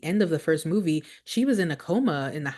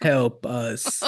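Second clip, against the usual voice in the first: robotic voice; 4 semitones lower than usual. Retold or imitated. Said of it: "talks in that weird robotic voice".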